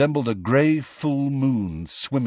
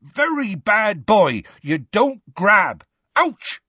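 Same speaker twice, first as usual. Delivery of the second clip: higher, slow scolding then a yelp; yelling; 10 semitones higher, 4 dB louder, slower than usual. A narrator's reading. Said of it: "heard Hagrid yelling".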